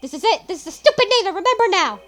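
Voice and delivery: loud, high exclamation of discovery; excited outburst. Acted. Detective suddenly recalling the virus name, loud and excited. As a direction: shout it as it clicks.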